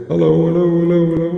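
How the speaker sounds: echoing mic check. Shown as a demonstration. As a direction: test the echo, calling hello. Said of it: "put a bit of echo on the voice".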